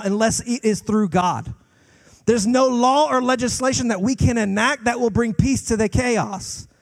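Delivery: impassioned preaching. Acted. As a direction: drive the point home with passion. Preaching climax.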